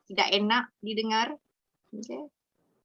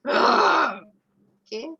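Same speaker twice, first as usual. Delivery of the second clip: gasp; 5 semitones higher, 6 dB louder than usual. Shown as a demonstration.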